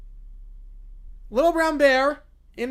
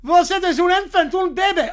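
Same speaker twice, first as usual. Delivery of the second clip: higher, louder scolding in French; 7 semitones higher, 8 dB louder than usual. Acted. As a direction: talk down to him in French.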